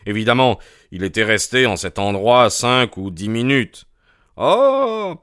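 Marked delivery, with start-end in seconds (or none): 4.4-5.1 high, drawn-out acted "ah"; surprised exclamation. Holmes hears how long Sir Charles stood there, surprised. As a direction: let out a high, held exclamation.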